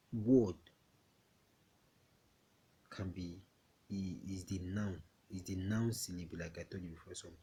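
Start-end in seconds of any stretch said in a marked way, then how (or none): none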